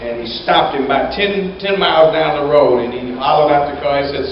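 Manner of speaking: ordinary talk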